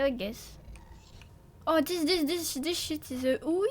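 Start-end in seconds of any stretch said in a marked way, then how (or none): none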